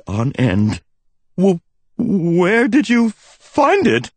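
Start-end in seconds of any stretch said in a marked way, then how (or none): 1.4-4.1 nervous stammer, high and slow. A narrator's reading. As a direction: stumble over the words in fright. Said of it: "I stammered".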